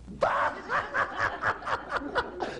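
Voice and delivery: squealing high laugh; high burst of laughter. Real, not acted. Narrator laughs at punchline.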